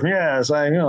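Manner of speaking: lightly voiced sly agreement; a hint of telegraphed menace; sly villainess. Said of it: "telegraphing it all the way".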